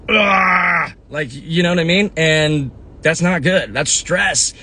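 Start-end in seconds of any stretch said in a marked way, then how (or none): 0.1-0.9 frustrated grunt. Retold or imitated. Heart pumping when stressed, angry.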